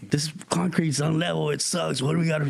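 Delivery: high, griping friends; whiny complaint. Retold or imitated. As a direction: complain about the practice spot. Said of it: "friends complaining".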